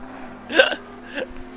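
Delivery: high, airless giggle; high breathy laugh. Real, not acted. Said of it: "[name] is laughing at me".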